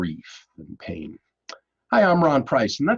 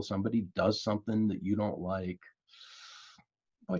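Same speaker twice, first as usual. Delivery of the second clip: normal talking voice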